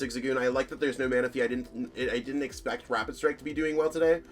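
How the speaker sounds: usual speaking voice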